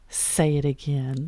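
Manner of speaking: low, slow coaxing man's voice; mock-seductive low request; imitated bachelor. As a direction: drop low and draw it out, playing the bachelor. Retelling the bachelor's coaxing reply.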